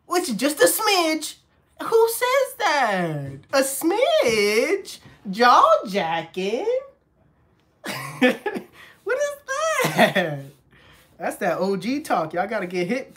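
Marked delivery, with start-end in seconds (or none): 1.8-10.4 high, slow disbelieving questions; can't believe what he heard; incredulous. Real, not acted. Coworker baffled by Glenn's old slang, laughing disbelief.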